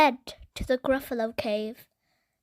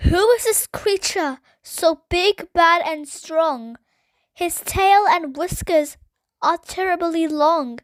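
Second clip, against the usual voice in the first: higher, louder, awestruck and frightened; awestruck fear; 5 semitones higher, 6 dB louder than usual. Acted. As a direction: gasp at the huge shadow. Child sees the terrible shadow.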